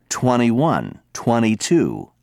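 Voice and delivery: twenty-one said twenny, slowly, no t; American twenty. Shown as a demonstration. Teacher showing students American numbers. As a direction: drop the t in twenty-one, twenty-two. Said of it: "Americans drop the T".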